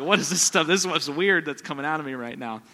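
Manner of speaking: half-joking startled self; mock bewildered outcry. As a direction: blurt it in mock alarm. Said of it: "I'd been like".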